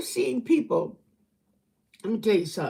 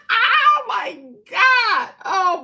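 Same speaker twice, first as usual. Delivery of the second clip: laughing; 10 semitones higher, 5 dB louder, much slower than usual. Real, not acted. Laughing at dumb comment.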